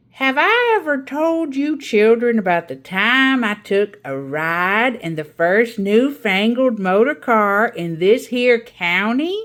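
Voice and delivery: put-on a proud old aunt holding the children spellbound; Aunt Ada's storytelling voice. Aunt Ada tells her tale.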